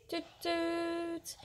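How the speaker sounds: high, soft drawn-out tooting; toy train toot. A narrator's reading. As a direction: imitate a little train whistle. Child playing with toy train.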